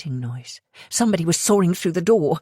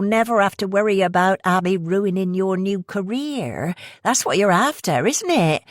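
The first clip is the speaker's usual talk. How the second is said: pushy West Country woman; 5 semitones higher than usual. Acted. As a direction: rush the sales pitch in a West Country accent. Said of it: "the accent heavy West Country".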